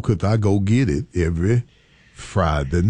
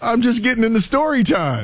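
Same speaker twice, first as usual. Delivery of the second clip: high, laughing protest; 13 semitones higher than usual. Real, not acted. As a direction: defend the story, half laughing. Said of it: "why are you ripping me up".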